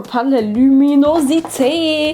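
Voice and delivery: loud, delighted outburst on "luminosité"; thrilled and exclaiming; gushing excitement. Real, not acted. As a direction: gush over it, swelling on the last word. Gushing over the bright eyeshadow.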